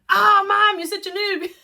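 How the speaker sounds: put-on high, loud exasperated kid teasing. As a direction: sigh it at clueless mom. Daughter mocking mom again.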